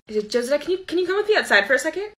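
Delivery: high, quick teacher voice; teacher calling aside. Retold or imitated. Teacher calling Josadak outside, high and quick. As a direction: call the student out briskly. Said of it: "she kinda just says".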